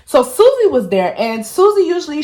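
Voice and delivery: ordinary delivery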